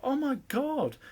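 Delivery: high-pitched exclamation of disbelief; retold dismay. Reacting to being attacked by someone in the group, high dismayed exclamation. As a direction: replay your dismay, pitch jumping right up.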